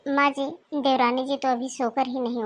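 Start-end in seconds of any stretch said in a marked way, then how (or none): none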